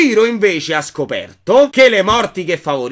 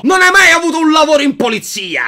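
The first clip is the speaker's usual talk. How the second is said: angry scolding; 6 semitones higher than usual. Acted. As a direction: tell him off hard. Daughter scolding father.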